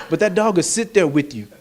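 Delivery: ordinary talk, nothing marked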